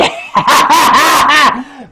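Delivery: high, loud burst of laughter. Real, not acted. Performer after his big reveal, loud high laugh. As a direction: laugh out loud, pitched well up.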